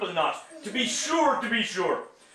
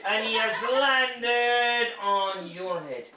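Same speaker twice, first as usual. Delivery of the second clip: slow dramatic; much slower than usual. Acted.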